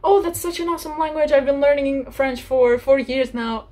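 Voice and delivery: high, excited gushing; acted enthusiasm. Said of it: "I'm gonna be like".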